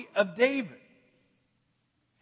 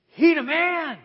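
high, loud street-talk verdict; the crowd sizing up the big son; swagger; 5 semitones higher, 6 dB louder, slower than usual. Acted. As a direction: boast it like a hype man.

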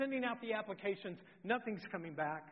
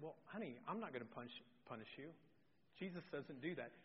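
very soft, lowered fatherly voice; gentle reassurance; 3 semitones lower, 12 dB quieter than usual. Retold or imitated. Father telling daughter he won't punish her, tender and hushed. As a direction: soothe the child quietly.

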